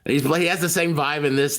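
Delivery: ordinary delivery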